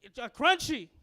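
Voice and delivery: sudden loud, high outburst; mock abrupt yelp of an order; imitating a blurt. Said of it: "blurts out".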